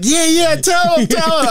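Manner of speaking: very high-pitched egging-on; retold crowd cheering. Fans urge the talking woman on, mock cheering. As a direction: imitate a crowd whooping her along.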